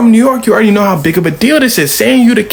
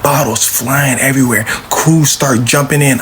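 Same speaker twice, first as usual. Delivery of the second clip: ordinary talk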